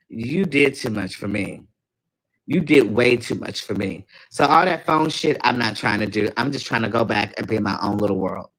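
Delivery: ordinary delivery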